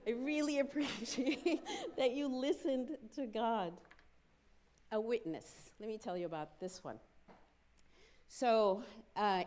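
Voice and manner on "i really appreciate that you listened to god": slow, loud, laughing gratitude; laughing thanks. Retold or imitated. Thanking John for listening to God, laughing.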